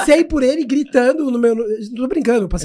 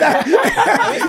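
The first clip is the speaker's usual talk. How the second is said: short high laugh; 12 semitones higher than usual. Real, not acted.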